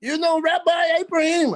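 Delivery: the rabbi asking about his brother; imitating the rabbi. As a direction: ask it like a delighted rabbi.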